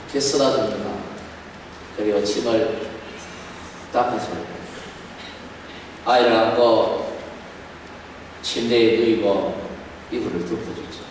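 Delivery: tender hushed storytelling; soft tender telling. Acted. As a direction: hush it like tucking a child in. Tender bedtime scene narrated softly.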